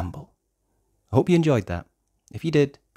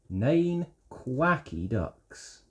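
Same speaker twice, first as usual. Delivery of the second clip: soft slow read; 7 dB quieter than usual. Acted. Softer slower repeat.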